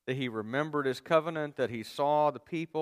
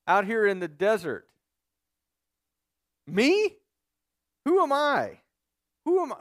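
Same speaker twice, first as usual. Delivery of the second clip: shocked disbelief; 8 semitones higher, 5 dB louder than usual. Retold or imitated. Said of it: "He's like".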